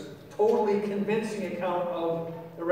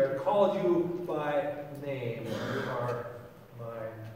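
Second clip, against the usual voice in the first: slow, sinking soft and low; tender reassurance; 5 semitones lower, 4 dB quieter, slower than usual. Acted. God claims the one who failed, tender reassurance. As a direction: let it settle gently into near quiet.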